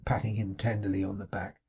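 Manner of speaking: ordinary delivery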